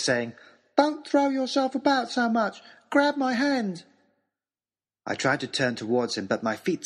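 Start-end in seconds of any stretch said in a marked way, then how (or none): 0.8-3.8 put-on much higher, clear boyish voice. A narrator's reading. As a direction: call out clear and high to steady him. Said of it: "Harry's clear, high voice".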